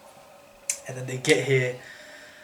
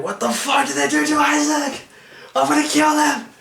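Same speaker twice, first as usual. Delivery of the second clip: high, loud enraged threat; furious yell; 11 semitones higher, 3 dB louder than usual. Retold or imitated. Friend threatening the guards, a raging high yell. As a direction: roar it out at the guards.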